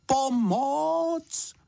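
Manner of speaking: high, drawn-out cry for help; tentative call for help. A narrator's reading. Bear in the bath first trying to call, tentative high call. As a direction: call out high, holding the vowels. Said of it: "Nejprve jen potichu".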